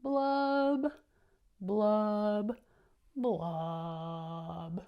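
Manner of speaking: loud popping blubs, falling at the end; fish blub sounds. Shown as a demonstration. Reader shows the class the fish's loud blubbing. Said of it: "In this part, he says".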